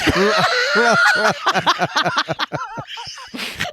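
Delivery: long, high peals of laughter; drawn-out high laugh; real laughing fit. Real, not acted. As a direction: crack up at your own tease. Cracking up at teasing remark.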